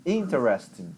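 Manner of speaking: "interesting" with full gas on IN; stress on first syllable. Shown as a demonstration. Teacher showing English stress, full push at the start. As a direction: attack IN in "interesting", then let it roll downhill. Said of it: "com gás total pra falar o início".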